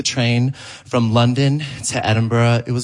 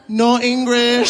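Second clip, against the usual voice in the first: slow, higher fake broken English; mock no english; 9 semitones higher, slower than usual. Comic faking no English to the angry man, pitched higher. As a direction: feign not speaking English. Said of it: "before saying".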